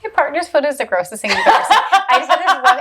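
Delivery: giggling while confessing; high, laughing disgust. Real, not acted. Laughing about partner's gross feet.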